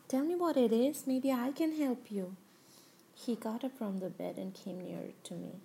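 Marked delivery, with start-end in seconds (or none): 0.1-2.3 higher, louder pressing demand; urgent insistence. Acted. Father-in-law urging tearful daughter-in-law to speak, loud and insistent. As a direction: push her to tell you, raising the voice.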